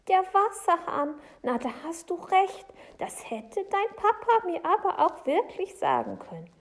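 high, loud, mildly reproachful; relieved Frau Segebrecht. Acted.

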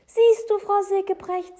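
high, very loud exclamation; triumphant proof. Acted. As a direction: call out that you were right. Boy triumphantly proves his point.

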